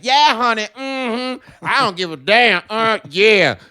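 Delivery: high, loud campy sass; loud sassy voices. Retold or imitated. Loud customers entering the grocery store, in a high sassy voice. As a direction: strut in with a loud, sassy honey. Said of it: "they're like".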